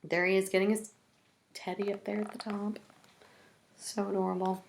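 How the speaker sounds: ordinary delivery, nothing marked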